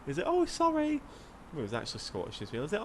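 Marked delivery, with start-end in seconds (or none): 0.3-1.0 put-on high childlike apology; little kid pilot. Young pilot apologising about his strobes, high childlike voice. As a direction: say sorry like a small child, high. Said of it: "this wee, like, baby".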